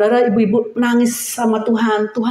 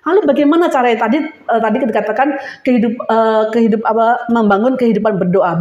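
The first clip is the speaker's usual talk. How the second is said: ordinary talk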